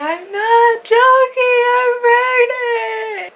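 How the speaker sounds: high, loud, long-drawn tearful cry; imitating crying wail. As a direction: wail each word out, re-enacting a desperate confession. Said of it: "I'm crying to this bitch".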